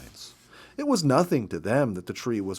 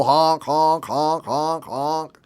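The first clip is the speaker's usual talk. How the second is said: porcupine honks; 5 semitones higher, 6 dB louder, much slower than usual. Retold or imitated. Porcupines interrupt the reading.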